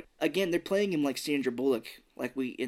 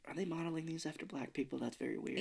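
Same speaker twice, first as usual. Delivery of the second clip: much softer, quick hushed remark; hushed aside; 2 semitones higher, 12 dB quieter, faster than usual. Retold or imitated. Reviewer recalling her uneasy thought, hushed. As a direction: drop to a hush like saying something you shouldn't. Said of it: "I was like".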